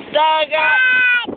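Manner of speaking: high, stretched call of "dagat"; demonstrated high shout. Shown as a demonstration. Parent leading child's shout, high long call.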